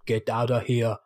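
low, half-hearted action-star impression; Arnold Schwarzenegger. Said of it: "like Arnold Schwarzenegger".

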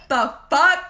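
mock indignant snap. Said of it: "you're like".

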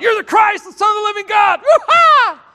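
high-pitched overexcited outburst ending in a whoop; excited shout. Retold or imitated. Peter blurting his answer to Jesus, excited high shout. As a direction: blurt it out at full shout, climbing into a yell. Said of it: "shouts out".

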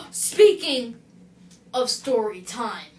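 slow, loud, drawn-out announcement; dramatic announcement. Acted. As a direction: boom it out like a show host. Dramatic segue to story time.

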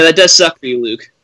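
ordinary talk

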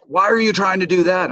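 mock furious. Rival racers confront him in the parking lot, mock furious. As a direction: replay the rivals' furious demand. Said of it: "furious with me".

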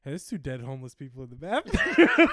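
loud announcement through laughter; loud laughing alarm. Acted. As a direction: shout the news while cracking up. Patron reports bodies, laughing.

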